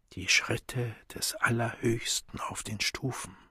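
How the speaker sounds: soft whisper, dying away; fainter whisper. A narrator's reading. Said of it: "Das Flüstern wurde leiser und leiser".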